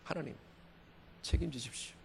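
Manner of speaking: very soft, surrendering prayer; hushed plea to God. Acted. Preacher repeats his cliff-edge plea to God, hushed. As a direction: drop to a near-whisper to God.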